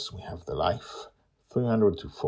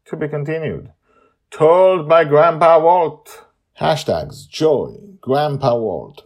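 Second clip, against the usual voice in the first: announcer outro; 4 semitones higher, 10 dB louder than usual. A narrator's reading.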